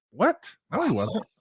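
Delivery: high-pitched, disbelieving retort; incredulous. Retold or imitated. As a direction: react in startled disbelief, pitch jumping up. Acted reaction to false claim.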